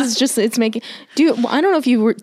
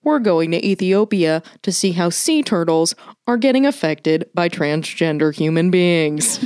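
mock documentary host, sinking lower and slowing to a crawl; Shane Smith; 5 semitones lower, slower than usual. Podcaster mocking absurd Vice story topics, stilted presenter impression. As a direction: spoof a solemn, stilted reporter intro. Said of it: "he's always like".

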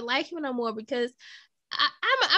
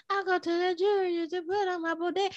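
higher put-on voice, jumbling the words; a goofy, higher impression of a corner-store regular; mock corner-store guy; 5 semitones higher than usual. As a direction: play the bodega guy for laughs. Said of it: "how the Puerto Ricans be looking at the corner store".

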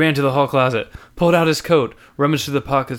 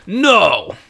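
loud, higher blunt no; gruff refusal; 10 semitones higher, 8 dB louder than usual. Acted. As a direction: snap a flat refusal. Homeless man refuses.

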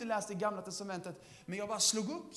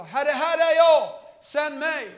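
louder and higher, Isaiah answering God; fervent call; 6 semitones higher, 14 dB louder, slower than usual. Acted. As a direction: cry out ready to be sent. (Jesaja ropar glödande)

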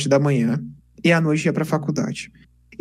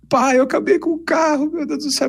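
retold crying; 10 semitones higher than usual.

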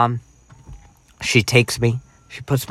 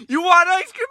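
excited mom. Retold or imitated. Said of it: "She goes".

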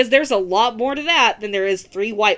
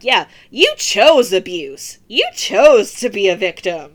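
mocking Sammy; 2 semitones lower, 7 dB louder than usual. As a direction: drag out the smug blame, louder. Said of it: "being like".

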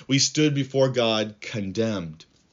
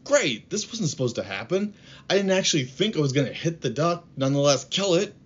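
higher, quick, alarmed self-talk; panicked inner thought; 6 semitones higher than usual. Retold or imitated. Boy panicking to himself after killing the duck, higher and fast. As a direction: blurt out the boy's dismay at himself, quickly. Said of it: "He's thinking to himself".

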